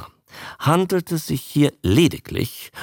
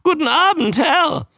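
high creature squawk; very high-pitched croak; croaking newt; 4 dB louder than usual. A narrator's reading. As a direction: croak the greeting like a parroting newt. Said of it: "krächzend".

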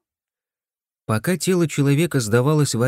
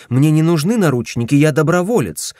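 loud protest; 4 semitones higher, 5 dB louder than usual. A narrator's reading. Protesting against handcuffs.

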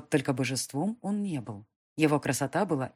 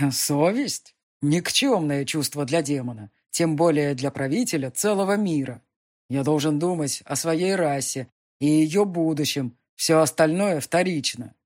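louder, scornful demon lord; disdainful demon; 6 dB louder than usual. Acted. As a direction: brush off conscience with contempt. Demon dismisses conscience.

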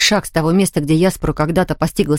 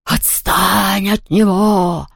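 slow, drawn-out protesting call; understated yell; much slower than usual. A narrator's reading. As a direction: stretch the order like a far-carrying cry. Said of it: "внезапно завопил Хуга".

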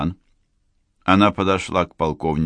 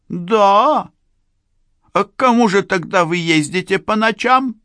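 much higher, louder sharp question; accusing shot; 5 dB louder than usual. Acted. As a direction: fire the question like a bullet. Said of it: "Вопрос вылетел, как пуля".

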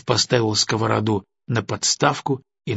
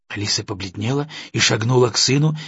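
usual speaking voice

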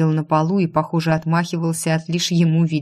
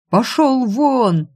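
high, drawn-out angry shout; loud, pitched-up panicked yell; 7 semitones higher, 5 dB louder, much slower than usual. A narrator's reading. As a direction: scream them away, stretching the words. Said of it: "заорал он".

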